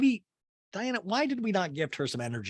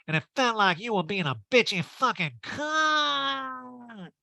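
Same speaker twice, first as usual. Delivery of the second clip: slow, tearful, higher whine; crying; 6 semitones higher, slower than usual. Retold or imitated. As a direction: sob it out slowly. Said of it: "And Erica's like".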